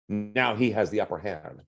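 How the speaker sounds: normal talking voice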